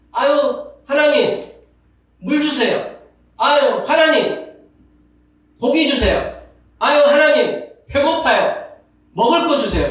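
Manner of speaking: high, loud whiny pleading; whiny begging voice, pitched well up; whining Israelites. Retold or imitated. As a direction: beg God like a spoiled complainer. Voicing complaining Israelites.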